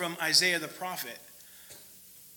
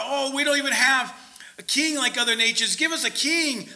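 higher, mock whining; whiny complaint; 6 semitones higher, 3 dB louder than usual. Israelites grumbling to Moses, mock whiny and higher. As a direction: moan like spoiled grumblers.

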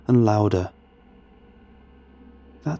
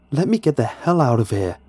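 desperate raised voice, louder and higher; restrained desperate yell; hoarse shout; 5 semitones higher, 4 dB louder than usual. A narrator's reading. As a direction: shout it out, but held back by narration. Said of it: "I shouted as loud as I could with my hoarse voice".

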